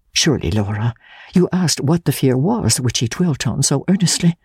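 low-pitched, earnest questioning; lightly voiced questioning sister.

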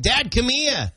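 put-on toddler's Maine-Boston "come he-ah"; Maine-Boston accent. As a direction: call Dad in a little kid's Maine accent. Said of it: "half Maine hybrid accent".

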